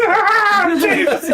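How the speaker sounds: high-pitched yelp of fright; mock scream. Sensei seeing the spider crawl closer, hammed-up scream. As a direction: squeal it in hammed-up terror through laughter.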